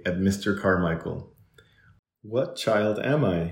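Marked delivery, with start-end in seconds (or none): none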